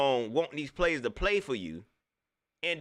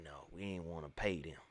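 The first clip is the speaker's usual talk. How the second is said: muttered aside; 11 semitones lower, 13 dB quieter than usual. Acted. Repeated much lower, softer.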